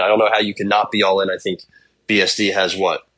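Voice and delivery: usual speaking voice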